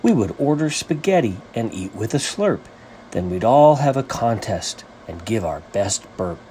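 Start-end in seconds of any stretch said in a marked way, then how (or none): none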